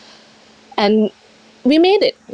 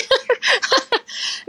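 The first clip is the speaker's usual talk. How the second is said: a bright, high burst of laughter; high giggle; 4 dB quieter than usual. Real, not acted. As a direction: giggle at your own joke. Joke about no longer being skinny.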